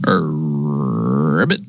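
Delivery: low drawn-out ribbit, flicked up at the end; frog croak. Pretend. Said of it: "That's the sound a frog makes".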